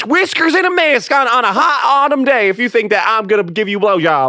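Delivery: high hammed-up Southern folksy talk; Southern accent. Accent game round, folksy Southern put-down. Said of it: "which is Southern".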